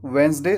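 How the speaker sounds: "वेडनेसडे" as wenz-day; two syllables. Shown as a demonstration. Teacher points out only two syllables, "wenz-day". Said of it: "दो ही syllable है".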